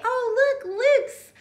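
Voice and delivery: bright, slow delighted discovery; high, drawn-out "look, Luke's light" for kids; excited. Shown as a demonstration. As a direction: gasp it like you just found the match. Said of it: "you can get really excited and say".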